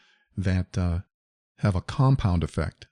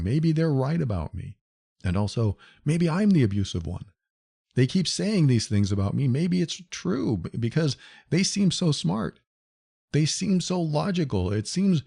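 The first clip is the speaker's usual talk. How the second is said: higher, unsure voice of a self-blaming victim; self-doubting victim; 7 semitones higher than usual. Retold or imitated. Victim doubting herself after abuse, higher and unsure. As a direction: voice the victim second-guessing herself, pitched up. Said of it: "victims of emotional abuse will blame themselves".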